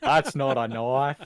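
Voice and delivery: loud, lightly Aussie movie quote; mock Crocodile Dundee. Quoting the movie, a loud mock Aussie accent. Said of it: "the only thing I can say Australian".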